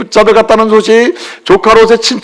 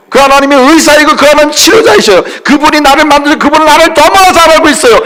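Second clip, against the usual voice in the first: raised, higher preaching voice building to hallelujah; fervent preaching; 8 semitones higher than usual. Acted. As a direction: preach it out with full conviction. Preaching at full force.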